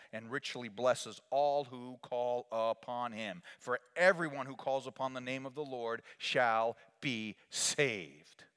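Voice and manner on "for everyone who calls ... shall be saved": usual speaking voice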